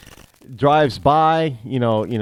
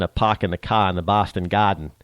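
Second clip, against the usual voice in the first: imitated Boston accent with dropped r's; 5 semitones lower than usual. Shown as a demonstration. Radio host showing an accent that annoys him, Boston accent. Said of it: "Boston accent".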